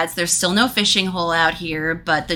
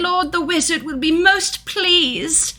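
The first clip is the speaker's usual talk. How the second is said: servant voice; 8 semitones higher than usual. Acted.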